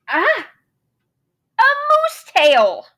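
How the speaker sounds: high, loud, slow cry of delight; excited discovery. Acted. Moose finds the answer, crying out with joy. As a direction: announce it like a eureka.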